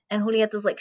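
ordinary talk

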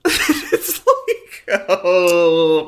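high laughing no no no. Real, not acted. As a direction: laugh it off in disbelief. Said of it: "laughing at storyline".

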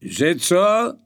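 loud, high shouted question; calling from downstairs. A narrator's reading. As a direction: yell back up from the floor below. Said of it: "zapytał Janek z dołu".

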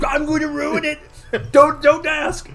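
high, laughing mock villain; hammy cartoon baddie.

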